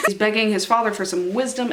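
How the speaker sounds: ordinary talk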